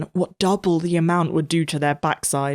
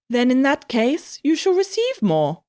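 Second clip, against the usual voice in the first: stern teacher voice; 8 semitones higher than usual. A narrator's reading. Cook the teacher speaks.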